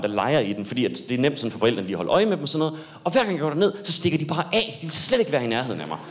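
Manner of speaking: usual speaking voice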